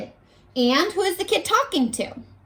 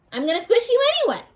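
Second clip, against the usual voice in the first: put-on bossy kid; 9 semitones higher, much faster than usual. Kid threatens ant in retell.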